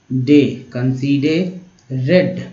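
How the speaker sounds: "कंसीडरेड" slow, in separate chunks; broken-down pronunciation. Shown as a demonstration. Teacher building a word for learners, slow chunked "कंसीडरेड". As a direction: split "कंसीडरेड" as con-see-de-red. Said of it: "ऐसे तोड़ के पढ़ना है".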